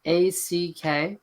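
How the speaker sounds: slow letter-by-letter spelling. Shown as a demonstration. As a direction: spell each letter out slowly. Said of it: "so I'm gonna spell it for you".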